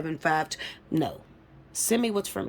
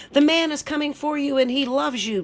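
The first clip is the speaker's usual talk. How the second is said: high, loud, gushing naive reading; mock beginner reader; 10 semitones higher, 6 dB louder than usual. Reader mocking a beginner's reading, high and gushing. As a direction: squeal it like a clueless newbie reader. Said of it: "some beginner tarot reader might read this as".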